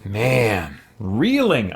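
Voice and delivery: usual speaking voice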